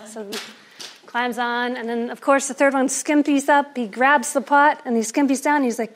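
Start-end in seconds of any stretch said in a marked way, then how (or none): none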